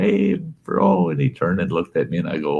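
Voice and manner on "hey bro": much higher, bright calling out; excited hello. Retold or imitated. Running over to greet his brother, calling out. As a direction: call to him as you run over.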